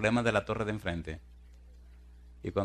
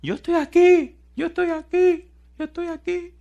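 very high, thin mock scream, fading with each call; imitated cry for help; 7 dB louder than usual. Buried woman calls to rescuers, imitated high cry. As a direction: call out in falsetto, as if from under rubble. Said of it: "ella comenzó a gritar".

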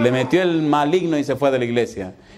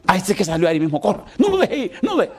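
desperate; 3 semitones higher than usual. Retold or imitated. Churchgoer urging the pastor to chase a man, desperate. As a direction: mock-desperate plea in a raised pitch. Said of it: "como desesperado".

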